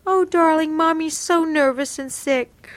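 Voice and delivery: high, whiny mocking mother; whiny mom voice. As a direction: whine it like a nervous, sickly mother. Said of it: "I hate her whiny voice".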